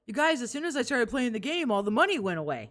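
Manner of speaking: high whiny mock of the streamer; mocking impression. Cohost mocking the streamer's complaint, high whine. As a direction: parody him complaining about money. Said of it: "He doesn't say it like that".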